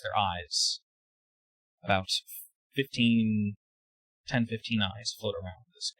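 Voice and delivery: ordinary delivery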